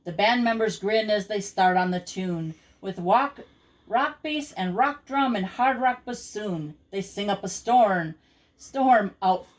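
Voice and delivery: usual speaking voice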